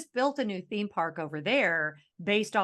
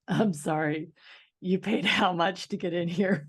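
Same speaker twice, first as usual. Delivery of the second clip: odd put-on alligator voice, laughing; snooty gator talking to visitors. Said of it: "she says in alligator".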